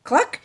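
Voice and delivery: high, loud hen cluck; happy cluck. Acted. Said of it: "said Dora happily".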